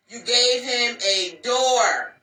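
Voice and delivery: slow, spaced out, each word landed; slow emphatic. Shown as a demonstration. Warning the ladies, slow deliberate repeat.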